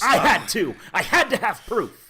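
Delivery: loud defiance. Acted. John shouts defense.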